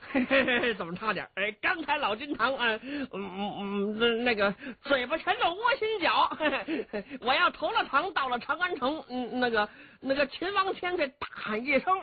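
high, giggly voice of a sly rough warrior; Cheng Yaojin's voice. Acted. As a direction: play the cunning bumpkin, chuckling and stammering. Cheng Yaojin speaks, storyteller voices him.

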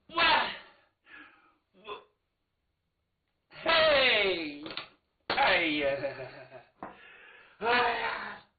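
loud, drawn-out theatrical wail; choked stammer bursting into loud wails; acted wailing cry. Daughter breaking down before her father, wailing. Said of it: "姑娘这一哭".

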